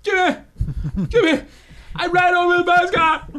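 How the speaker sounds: high, loud panicked outburst; shouting. Acted. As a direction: yell it out in mock horror. Mock mascot hit-and-run outcry.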